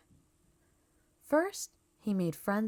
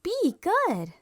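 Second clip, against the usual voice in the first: higher and louder alien voice, proud; 11 semitones higher, 6 dB louder than usual. A narrator's reading. E T copying Gertie's praise, higher alien voice. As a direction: alien echoing praise, strange timbre.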